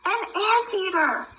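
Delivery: storybook excitement; excited discovery. Acted. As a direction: point and shout with glee for the baby. Reading to baby.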